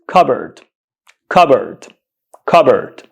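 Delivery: cupboard as "KUB-erd", weak second syllable; correct pronunciation. Shown as a demonstration. Teacher confirming it for learners, cupboard as "KUB-erd". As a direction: say "cupboard" with silent P, "KUB-erd", each separately. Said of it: "The correct pronunciation of this word is".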